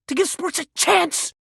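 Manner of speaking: usual speaking voice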